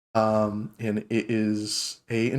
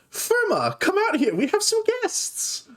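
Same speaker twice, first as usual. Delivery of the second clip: very high sing-out call, no extra volume; young dwarf girl calling out. Acted. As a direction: call your sister out in a squeaky-high voice. Dwarf girl calls sister out.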